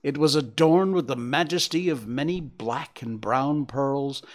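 usual speaking voice

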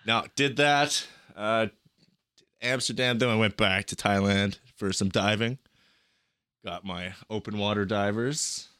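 ordinary talk

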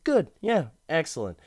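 jumps high on good, then eases off; a pleased, superior little yelp; smug rich guy. Retold or imitated. Rich client answering the salesman, smug and high.

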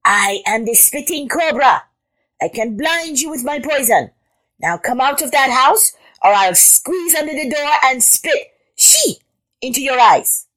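high, theatrical cobra threat; loud horn voice. A narrator's reading. Frog posing as a cobra to intruder, loud threat. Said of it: "made her voice very loud".

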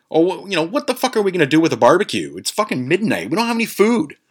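high-pitched imitation of a baffled objection; incredulous friend. Friend questioning a barbecue at midnight, incredulous. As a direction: voice a buddy protesting, pitch way up.

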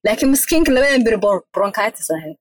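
usual speaking voice

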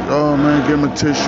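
loud high call for a tissue; coach calling out. Retold or imitated. Coach yelling for a tissue for the bleeding dad, loud and high. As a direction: shout across the field like the coach. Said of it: "The coach said".

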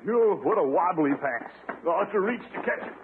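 ordinary talk, nothing marked

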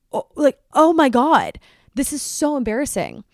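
loud, dramatic dismay; mortified. Retold or imitated. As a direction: wail it in comic mortification. Reliving embarrassment at the fake bag.

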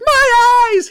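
very high, loud comic shriek; hammed-up scream of pain; mock agonized scream. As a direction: cry out like a blinded stuntman. Retold stuntman hurt by explosion.

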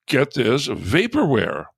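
normal talking voice

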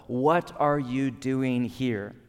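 slow, drawn-out, probing the listener; slow searching question. Acted. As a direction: ask it slowly, right at their soul. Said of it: "Put another way".

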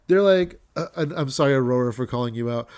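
ordinary delivery

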